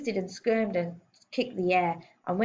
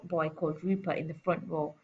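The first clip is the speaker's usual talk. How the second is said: ordinary delivery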